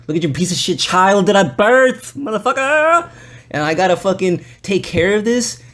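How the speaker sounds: hammed-up furious mother, pitch climbing; imitating mother's yelling. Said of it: "she just argues with me".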